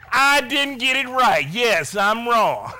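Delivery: loud, high comic concession; mock exasperated admission. Preacher voicing men conceding to Paul, mock exasperation. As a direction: concede it grudgingly for laughs.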